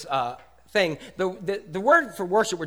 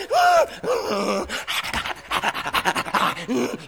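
excited gasp; 3 semitones higher than usual. Retold or imitated. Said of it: "you're like".

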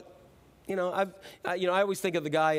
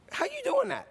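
very high, incredulous squeak; high-voiced comic wonder; mock amazement. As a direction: ask it way up high in pitch. Imagined amazed question to Jesus.

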